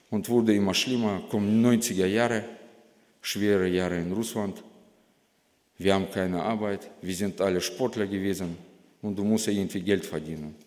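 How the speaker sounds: usual speaking voice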